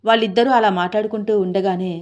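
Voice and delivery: ordinary delivery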